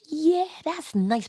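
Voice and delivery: put-on high, slow toddler voice; baby's voice. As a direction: play the curious baby brushing you off. Baby answering the parent.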